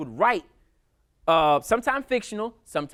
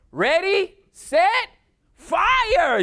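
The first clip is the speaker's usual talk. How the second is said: high, loud command; defiant soldier's command; 13 semitones higher, 8 dB louder, much slower than usual. Retold or imitated. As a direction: bark the firing order. She orders her own execution.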